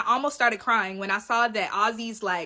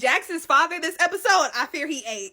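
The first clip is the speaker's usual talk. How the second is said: ordinary delivery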